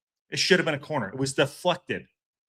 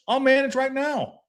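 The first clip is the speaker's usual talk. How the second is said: higher, slower "I'll manage right now", each word pushed; defiant boast; 10 semitones higher than usual. Real, not acted. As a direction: prove you can do it, raising the voice. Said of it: "You don't think I can manage?".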